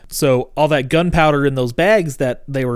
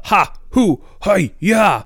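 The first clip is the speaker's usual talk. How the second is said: short, higher-pitched martial yelps; mock training shouts; 6 semitones higher, much slower than usual. Teaching them how to use staffs, mock martial shouts. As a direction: imitate trainees counting off staff strikes.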